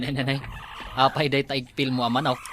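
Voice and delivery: ordinary talk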